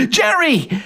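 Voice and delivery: high desperate call. Acted. Fliss calling to Jerry as she's tied, high. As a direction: cry out his name. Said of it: "She calls out".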